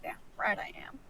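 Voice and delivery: whispered under the breath; muttering. Acted. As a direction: mutter it sarcastically, barely voiced. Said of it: "She mutters under her breath".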